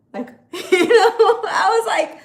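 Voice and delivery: bursts into loud laughter mid-line; laughing. Real, not acted. Laughs retelling her panic.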